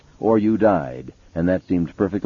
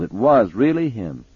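ordinary delivery

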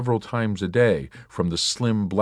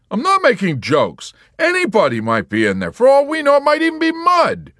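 high, loud insistence; Yossarian; 9 dB louder than usual. A narrator's reading. Yossarian unsettling the nurse, loud and high. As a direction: push the eerie idea.

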